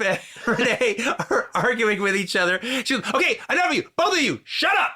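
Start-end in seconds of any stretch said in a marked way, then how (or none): 3.1-4.9 higher, fed-up acted outburst; exasperated scolding. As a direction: cut off two squabblers, voice jumping up. Acting out a film argument.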